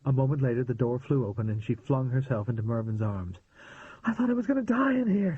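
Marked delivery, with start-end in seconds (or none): 4.0-5.4 higher voice on the verge of tears; near tears. A narrator's reading. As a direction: hold back tears, barely. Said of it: "she began to cry".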